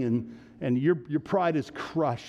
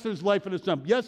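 ordinary delivery

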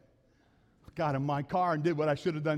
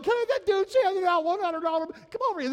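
much higher, mock excited stranger; eager crowd voice; 13 semitones higher, 5 dB louder than usual. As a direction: play someone begging for the money, high. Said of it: "they're like".

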